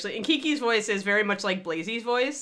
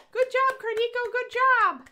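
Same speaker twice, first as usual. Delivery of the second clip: cheery praise; 4 dB louder, slower than usual. Real, not acted. Praising someone in chat.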